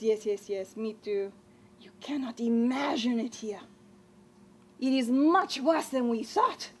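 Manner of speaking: ordinary talk, nothing marked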